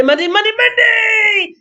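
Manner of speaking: high, loud rolling chant; hyped-up shout. Real, not acted. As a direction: whoop it out like a morning hype man. Hyped Monday greeting.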